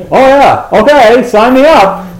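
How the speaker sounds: hammed-up loud phone voice, agreeing eagerly; loud phone talker. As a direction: mimic a stranger booming into a phone headset. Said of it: "I hear people go".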